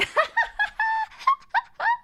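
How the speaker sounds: high-pitched giggling squeal; high squealing giggle. Real, not acted. Giggle about blushing.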